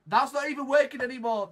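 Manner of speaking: fast, high, loud complaint; exasperated shout. Real, not acted. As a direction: blurt it out in disbelief. Outburst as device fails.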